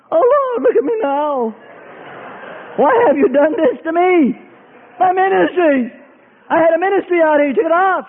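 high, crying-like lament; high mock weeping; mock wailing complaint. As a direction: wail about the lost ministry. Believer complaining to God.

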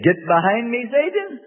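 Satan flung up high; sharp rebuke. Retold or imitated. Preacher voicing a believer rebuking Satan, high sharp cry. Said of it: "did we say".

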